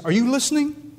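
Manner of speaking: high-pitched, insistent question; challenging God. Acted. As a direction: demand an answer from above. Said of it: "you look at him and say".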